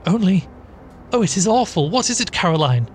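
high, distressed plea; horrified appeal. A narrator's reading. Sister begging Caroline for an answer, horrified. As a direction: start high and appalled, ease down.

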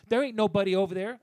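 imitating the worker denying it; mock indignant. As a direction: snap back like the worker. Retold worker arguing.

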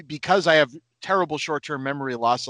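ordinary talk

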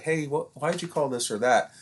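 lightly voiced curious parent. Parent asking the coach, in a curious tone. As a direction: ask it as though you truly want to know. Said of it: "in a curious tone".

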